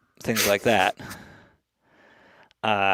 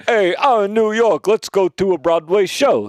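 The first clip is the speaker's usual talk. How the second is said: loud, high mock New Yorker; hammed-up tourist-in-New-York shout; Wayne's World scene; 7 semitones higher, 5 dB louder than usual. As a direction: call it out big and high. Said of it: "like that scene in Wayne's World".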